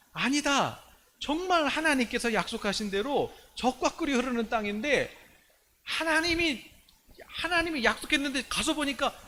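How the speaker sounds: high-pitched, fervent rallying speech; impassioned faith declaration. Acted. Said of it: "이렇게 두 사람은 믿음으로 선포하고".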